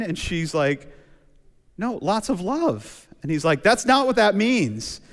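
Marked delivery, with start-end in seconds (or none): 1.8-3.1 innocent mom voice. Retold or imitated. Mom explaining text meaning. 3.6-5.0 exasperated son. Retold or imitated.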